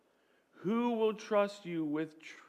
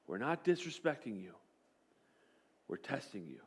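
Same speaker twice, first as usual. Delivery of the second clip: much softer and lower, hushed; soft reassurance; 6 semitones lower, 9 dB quieter than usual. Retold or imitated. Coach quietly reframing the test for his quarterback, soft. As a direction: let it land gently. Mindset shift, coach's words recast.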